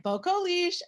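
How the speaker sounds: high sing-song nickname; playful nickname call. Retold or imitated. Friend calling out a new nickname. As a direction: toss the nickname up brightly.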